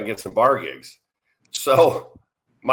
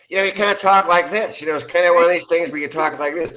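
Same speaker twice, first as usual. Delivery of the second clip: [name]'s speaking voice; 5 semitones higher than usual. Shown as a demonstration. Showing a friend how the singer used to talk, high-pitched impression. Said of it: "but he had a higher speaking voice".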